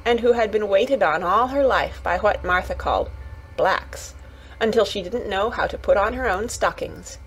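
usual speaking voice

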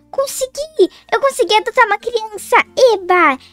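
high gasp, then a cheer; triumphant gasp. Acted. Girl finishes the adoption form, gasping with joy. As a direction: burst out in triumph.

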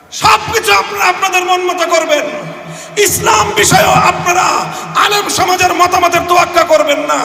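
indignant rally shout; fiery preaching. Real, not acted. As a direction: hurl the accusation at the crowd. Rally speech, rising anger.

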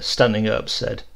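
ordinary delivery

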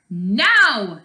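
loud urgent "Now!"; shouted order; loud command. Acted. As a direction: give the signal. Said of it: "said Kia".

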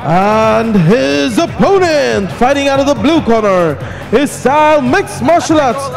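usual speaking voice

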